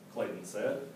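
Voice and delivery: ordinary delivery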